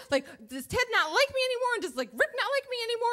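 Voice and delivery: high-pitched spiraling worry; panicked overthinking. Retold or imitated. Rider fretting both dates have lost interest, panicked. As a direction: let anxious questions tumble out high. Mind going into overdrive.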